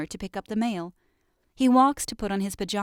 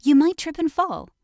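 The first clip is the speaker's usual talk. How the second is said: calling out; 6 semitones higher, 6 dB louder than usual. A narrator's reading. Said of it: "calls his dad".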